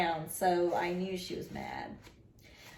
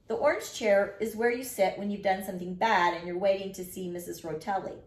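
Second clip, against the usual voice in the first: plain reading voice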